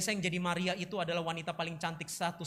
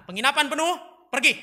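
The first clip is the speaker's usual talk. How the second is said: loud, raised line reading; drama line; 9 semitones higher, 12 dB louder than usual. Acted. Drama teacher gives Brian his line, loud and high. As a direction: deliver a simple eviction line, loudly. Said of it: "Teksnya cuma sederhana".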